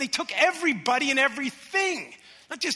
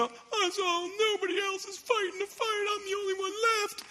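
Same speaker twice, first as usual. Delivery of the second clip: self-pitying whine; 9 semitones higher, 3 dB quieter than usual. Acted. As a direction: wail like you're all alone, hammed up. Acts out self-pitying, discouraged prophet.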